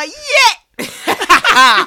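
loud, high party whoop; mock cheer. Sister mimicking relatives cheering at dances, mock cheer.

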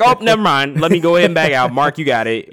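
high and loud, a hammed-up retreat; mock character voice. Steven handing the fight to Mark, high and loud. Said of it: "he's like".

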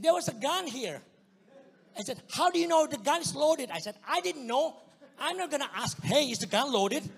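loud, high, exasperated arguing; indignant retort. Retold or imitated. He argues with the police about the gun, loud and exasperated. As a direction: fire back in disbelief.

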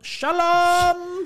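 high, loud, drawn-out hail; calling out. Retold or imitated. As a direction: holler a greeting from a passing car. Acting calling out of car window.

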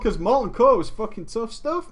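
ordinary talk

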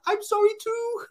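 very high, squeaky puppy voice; the dog apologising to his owner in a tiny pitiful voice; voicing the dog. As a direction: squeak it like a sorry little dog. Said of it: "And he was like".

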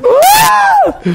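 very high, loud mock wail; hammed-up crying scream; pitiful wail. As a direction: let out an exaggerated sobbing shriek. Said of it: "I just remember being pitiful".

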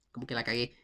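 ordinary talk, nothing marked

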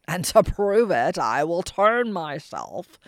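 slow, heavy ogre boast; growling ogre. Acted. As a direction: drag it out like a smug brute. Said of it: "growled the ogre".

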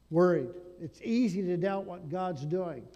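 normal talking voice

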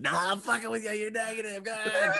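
teasing scare climbing into a very high voice; hammed-up, high-pitched prankster; mocking impression. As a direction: parody a doctor laughing off the scare. Mocks careless doctors' prank.

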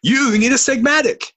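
high, loud, over-eager pitchman; infomercial announcer. Pretend. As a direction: sell it like a late night ad. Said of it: "a late night infomercial".

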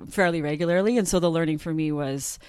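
usual speaking voice

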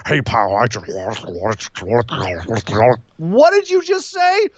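mock gravelly garbled old man, rising into a squeak at the end; hammed-up garbled Vince impression; Vince McMahon gargle. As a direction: gargle the words like a hoarse old boss. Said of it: "That's literally what he sounds like to me".

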